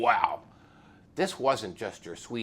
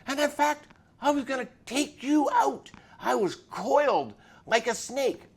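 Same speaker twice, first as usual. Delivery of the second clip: biker's confession; 11 semitones higher, 8 dB louder than usual. Retold or imitated. Biker tells cop his plan.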